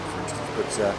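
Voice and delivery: ordinary talk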